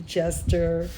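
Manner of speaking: plain reading voice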